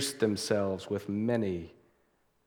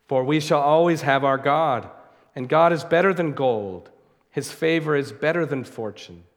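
louder, raised, fervent preaching; preaching at full force; 4 semitones higher, 5 dB louder than usual. Acted. Preacher reading Spurgeon to his congregation, building to a fervent peak. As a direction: lift the congregation with rising conviction.

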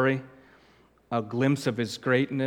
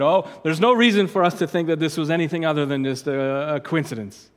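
louder, higher, hurried mock-scholar quote; dismissive quoting; 4 semitones higher, 7 dB louder, faster than usual. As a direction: rattle it off as a silly claim. Dismissively quoting a commentary he rejects.

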